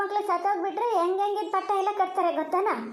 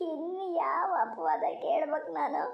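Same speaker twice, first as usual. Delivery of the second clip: tearful lament; 2 semitones higher than usual. Acted. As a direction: wail as one who has endured everything. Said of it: "ಅಳ್ಬೇಡಿ". (ಅಳುತ್ತಾ ನೊಂದ ಧ್ವನಿ)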